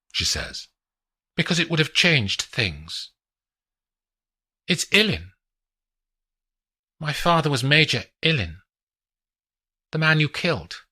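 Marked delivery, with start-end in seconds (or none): 1.4-10.9 lightly voiced young woman, higher; a hint of a higher female voice; woman showing off the view; Adelina's voice.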